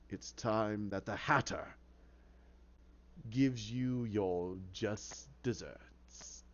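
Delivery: low, slow, sinister villain voice; Mad Hatter taking over. Acted. Hatter personality seizing control of Jervis, menacing. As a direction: savour it slowly, dropping deep and soft.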